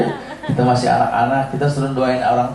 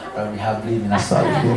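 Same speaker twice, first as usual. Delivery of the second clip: calm, softer, easy prayer before the trials; contented prayer; 5 semitones lower, 4 dB quieter than usual. Retold or imitated. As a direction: pray it peacefully like a happy parent. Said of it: "Cuma intonasinya aja yang berubah".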